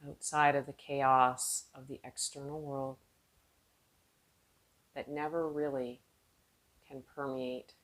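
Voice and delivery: calm, unhurried meditation voice with long pauses; guided meditation. Real, not acted. Yoga teacher guiding seated students inward, calm guided meditation. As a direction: ease them inward, letting phrases trail off softly.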